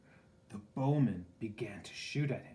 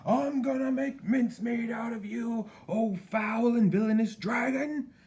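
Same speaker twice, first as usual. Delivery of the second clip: much higher, louder royal threat; king's furious shout; 13 semitones higher, 9 dB louder than usual. A narrator's reading. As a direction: bellow a threat as king. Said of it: "shouted the king".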